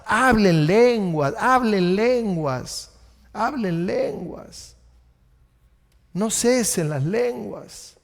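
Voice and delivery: very slow repeated urging; slow preaching. Shown as a demonstration. Pastor urging believers to pray in tongues, very slow. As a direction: draw each "hablen lenguas" out slowly. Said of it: "yo les recomiendo".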